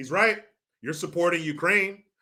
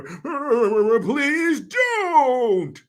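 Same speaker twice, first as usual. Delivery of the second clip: mock feeble old man; 5 semitones higher than usual. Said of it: "shaking, shivering old fool".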